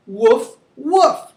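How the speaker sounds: two woofs, second high and loud; dog woof. Shown as a demonstration. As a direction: bark like a dog for the class. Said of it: "talk about the sounds".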